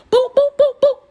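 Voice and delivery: high loud boop noises; high mock hit sounds; punch sound effects. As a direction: imitate rapid punches. Imitating punches in the fight.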